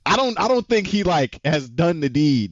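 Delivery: ordinary talk, nothing marked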